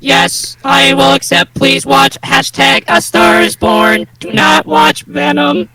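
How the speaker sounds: stiff robotic monotone, slowly. Shown as a demonstration. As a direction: read it like a robot. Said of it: "robot voice".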